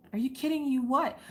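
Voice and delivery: high, rising incredulous question; mock stunned questioning; retold disbelief. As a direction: echo it back, baffled.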